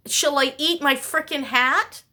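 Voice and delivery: ordinary delivery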